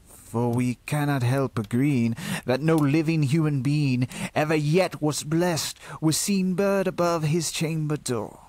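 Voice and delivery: ordinary delivery, nothing marked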